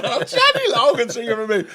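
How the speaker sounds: high, loud, laughing swoon; starstruck gushing. Retold or imitated. Player thrilled the singer performs for him, loud laughing gush.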